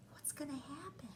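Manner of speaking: hushed whisper; suspenseful whisper. Acted.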